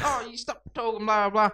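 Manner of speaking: high mock-teacher scolding.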